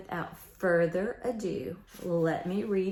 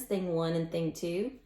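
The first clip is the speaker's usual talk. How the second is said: ordinary delivery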